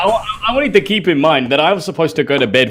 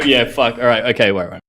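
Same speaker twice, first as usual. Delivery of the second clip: usual speaking voice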